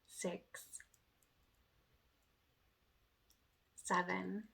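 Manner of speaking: slow, soft counting with long pauses; guided counting. Acted. Instructor counting slow reps for viewers, softly. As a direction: count the reps gently, letting each number settle.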